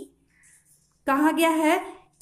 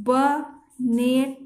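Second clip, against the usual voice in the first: slow careful word demo; much slower than usual. Shown as a demonstration. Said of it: "अब इसे कैसे बनाएंगे".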